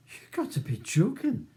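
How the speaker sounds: high scornful Glaswegian scoff. A narrator's reading. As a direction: scoff at the idea. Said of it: "says the smaller man scornfully".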